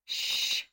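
soft, voiceless hushing; warning shush. A narrator's reading. Farnsworth warning Nancy to keep quiet, warning shush. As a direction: shush her quickly. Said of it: "warned Farnsworth".

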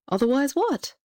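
high, questioning queen's voice. A narrator's reading. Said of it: "said the queen".

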